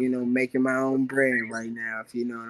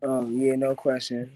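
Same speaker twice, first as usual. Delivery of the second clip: ordinary delivery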